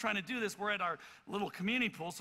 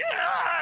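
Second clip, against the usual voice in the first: loud, high yell on surfacing; hammed-up panicked scream; imitated scream; 10 semitones higher, 11 dB louder than usual. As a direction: yell like a kid popping up from the water.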